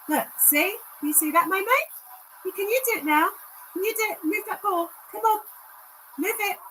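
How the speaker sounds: high sing-song dog owner voice; coaxing pet talk. Acted. Encouraging the dog spirit to move the ball, high coaxing pet voice. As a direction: sweetly coax her to try.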